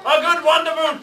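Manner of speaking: high, loud crew echo; pirate crew chorus. Acted. Pirate crew echoes the captain, loud rowdy chorus. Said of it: "they all agreed".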